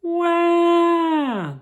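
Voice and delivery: put-on high loud baby cry; baby wail. Narrator voices the crying baby on the plane.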